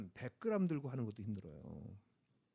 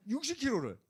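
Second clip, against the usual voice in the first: normal talking voice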